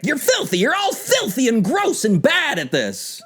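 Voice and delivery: very high mock-hysterical scolding; hammed-up frantic coach berating players; coach gone mad. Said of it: "He grabs the hot nozzle and sprays them".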